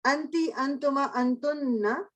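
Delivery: slow, drawn-out अंति, अंतुमा, अंतुन्ना with a rising end; drilled feminine pronouns. Shown as a demonstration. Teacher drilling feminine pronouns with the class, slow and stretched. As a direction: recite अंति, अंतुमा, अंतुन्ना slowly, stretching the last word. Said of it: "अब feminine".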